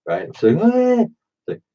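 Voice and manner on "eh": very high, drawn-out whine; mock freak-out squeal. Waitress freaking out at the cook, mock squeal. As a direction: squeal like a panicking waitress, exaggerated. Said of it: "She's, like, freaking out".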